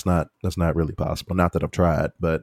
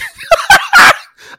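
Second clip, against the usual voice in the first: real loud, very high cackle; laughing uncontrollably; loud helpless laughter; 14 dB louder than usual. Real, not acted. Cracks up attempting a different voice.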